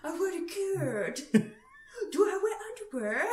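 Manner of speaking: high, giggly copy of fake Scottish; botched Scottish accent. Copying a friend's kilt joke, botched Scottish accent. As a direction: echo the bad Scottish accent. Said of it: "doing my best Scottish accent".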